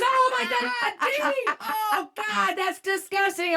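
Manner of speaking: high, loud wail of disgust; mock horrified shout. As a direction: cry out as a grossed-out man. Imitating the man's disgusted reaction.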